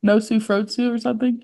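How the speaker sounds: Nosu Frotsu with a goofy twist; funny mispronunciation. Retold or imitated. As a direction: say Nosu Frotsu in a silly accent. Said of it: "Don't say it like that anymore".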